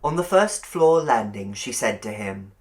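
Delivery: ordinary delivery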